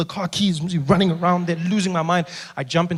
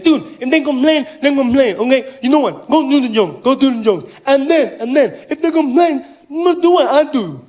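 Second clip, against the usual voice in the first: high, loud voice of a deaf man; insistent deaf fan; 8 semitones higher, 6 dB louder than usual. Acted. Deaf fan tells comedian to toughen up, high loud insistence. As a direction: press the point loudly in deaf speech. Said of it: "he was partially deaf".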